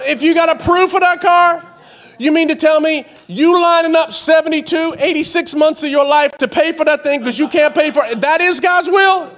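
high, loud, laughing disbelief; mock the reasoning from the pulpit; laughing, incredulous preacher; incredulous preaching. Preaching continues.

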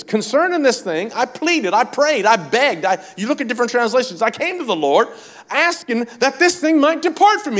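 high, building preacher's plea; pleading preaching. Acted. Apostle begging the Lord for relief, pleading preaching.

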